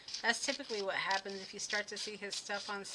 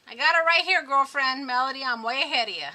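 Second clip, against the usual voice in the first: much louder, high and playful, talking to a friend; sassy loud reply; 5 semitones higher, 14 dB louder than usual. Real, not acted. Crafter loudly teasing a viewer in chat, sassy and excited.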